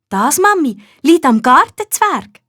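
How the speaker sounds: put-on a girl sure of her secret; knowing little girl. A narrator's reading. Nina explains the tomatoes to mum, proud child. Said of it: "erklärt Nina".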